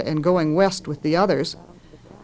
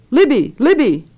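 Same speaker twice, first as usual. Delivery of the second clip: loud high screamed name; screaming; 6 semitones higher, 6 dB louder than usual. A narrator's reading. Mother screaming for her daughter in a burning house. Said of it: "Mama was screaming her name".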